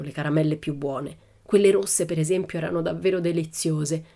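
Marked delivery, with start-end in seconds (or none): none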